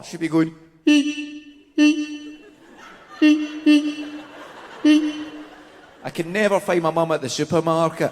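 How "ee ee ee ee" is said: string of high, loud, separate beeps; mock horn-blast laugh, spaced out; mum's repeated one-note laugh. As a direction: imitate a laugh as evenly pitched squeals. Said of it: "you get a few ha's from her".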